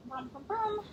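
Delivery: light, high-pitched "brum, brum, brum"; little rising motor-like noises; playful sound effect. Shown as a demonstration. Yoga teacher showing the strap going up, playful sound effect.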